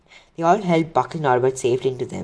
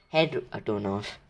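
plain reading voice

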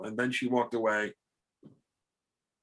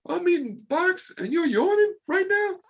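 very high, loud, slow mocking falsetto while laughing; teasing imitation; 7 dB louder than usual. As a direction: lampoon her complaint in a shrill squeaky voice. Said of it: "he goes".

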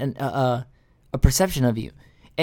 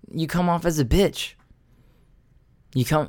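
ordinary talk, nothing marked

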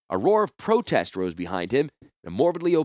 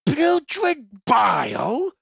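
very high, slow, loud shriek; outraged merchant; 5 dB louder, much slower than usual. Acted. The merchant shouts back at Gerard's insult. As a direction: drag out the outrage. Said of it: "the merchant shouted".

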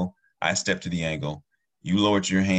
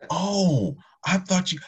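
high, louder startled "oh"; surprised realization; 11 semitones higher, 4 dB louder, slower than usual. Retold or imitated. Neighbor learning it was just a wave, surprised. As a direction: act out suddenly catching a misunderstanding.

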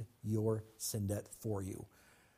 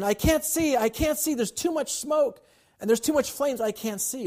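put-on much higher, louder frightened boy's cries; panicked boy; 6 dB louder than usual. Boy on the burning roof calling to his father, panicked high cries. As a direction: shout blindly through the smoke. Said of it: "the boy was saying".

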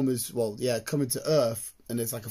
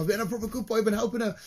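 put-on higher, sing-song little boy; childish boast; 7 semitones higher, faster than usual. To embarrass the till lad, a higher childish voice. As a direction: play the proud good little boy. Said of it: "I was just trying to embarrass him".

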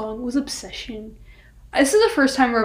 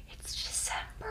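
whispering; 17 dB quieter than usual. Real, not acted. Aside whispered to the listeners.

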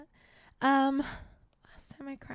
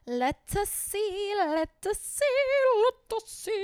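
sing-song; 7 semitones higher, 5 dB louder, slower than usual. Real, not acted.

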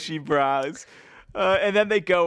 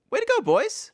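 mock cheer.

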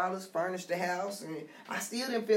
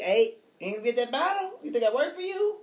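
sassy, raised mock scolding of the kids; playful mom sass, pitched up; slick sass; 6 semitones higher, 4 dB louder than usual. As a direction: toss it off like a slick jab. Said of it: "I'ma say something slick".

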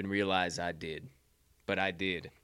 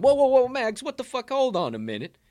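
much higher, loud cry to stop the spiral; self-scolding shout; 14 semitones higher, 8 dB louder than usual. Pretend. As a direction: shout a friend back to calm.

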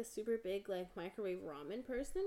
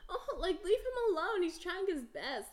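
laughing protest; 8 semitones higher, 4 dB louder than usual. Real, not acted. Defending small Pokemon, laughing.

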